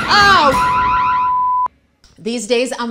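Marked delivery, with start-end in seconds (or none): 0.1-0.6 loud, high yelp; startled yell. Acted. Mom sneaking into neighbor's trash, startled yell. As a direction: yell out in sudden alarm.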